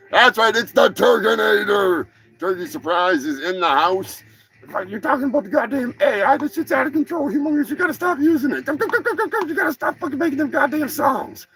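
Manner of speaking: a cocky cartoon turkey boasting; turkey character.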